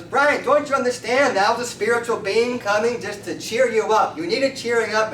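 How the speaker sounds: acted emphatic explaining. Friend explains the spiritual visitor to Brian, emphatic insistence.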